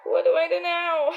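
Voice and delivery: high, loud cry for help; real frustrated wail; exasperated cry. Real, not acted. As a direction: throw the question up in despair. Lost mid-painting, exasperated cry.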